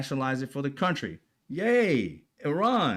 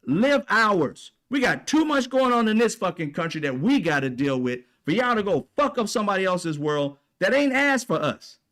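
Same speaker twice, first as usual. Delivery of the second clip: furious rant; 5 semitones higher than usual. Real, not acted. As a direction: let the anger pour out, shouting.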